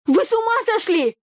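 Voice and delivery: panicked scream. A narrator's reading. Heroine screams in panic at the fighting brothers. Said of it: "В панике закричала я".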